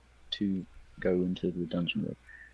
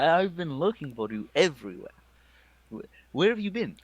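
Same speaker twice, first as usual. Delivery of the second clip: higher, animated character voice; raised pitch, exasperated relief; worried ranger friend; 6 semitones higher than usual. Acted. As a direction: exclaim to friends you've been searching for.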